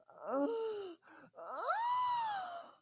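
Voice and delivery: loud, high drawn-out gasping; hammed-up rapturous gasp; exaggerated gasps. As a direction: gasp hugely at your own thought. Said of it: "That's me thinking about how tight".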